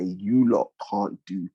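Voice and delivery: lightly voiced gloating rich state; mock Qatar voice. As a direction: voice Qatar as a rich, untouchable power. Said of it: "Qatar has all the money in the world to say".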